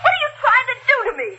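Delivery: high, rushed, tearful protest; distraught outburst. Acted. Wife under accusation snaps at detective, distraught outburst. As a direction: lash back, close to hysterics.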